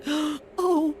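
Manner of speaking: a frozen bunny pleading to come in; shivering rabbit. Acted. Rabbit begs the mouse to let her in, high and whimpering. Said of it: "and she said".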